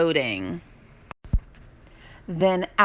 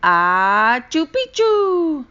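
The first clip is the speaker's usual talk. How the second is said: loud, high, stretched sneeze-word; half-sneezed password; 8 semitones higher, 8 dB louder, much slower than usual. A narrator's reading. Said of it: "half sneezed, half spoke".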